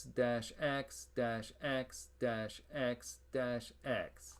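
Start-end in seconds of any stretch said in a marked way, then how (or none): none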